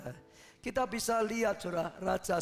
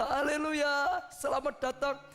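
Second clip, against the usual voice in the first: higher, slow parrot call; lightly voiced parrot impression; imitating the parrot; 7 semitones higher than usual. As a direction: mimic the bird's welcome.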